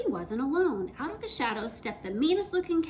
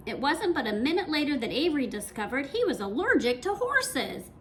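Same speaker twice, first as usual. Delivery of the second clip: plain reading voice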